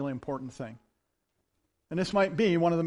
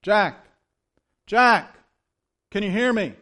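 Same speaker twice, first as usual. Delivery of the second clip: calling down a name, louder; 3 semitones higher, 8 dB louder than usual. Acted. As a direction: call out to him from above. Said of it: "he hears a voice, and it says".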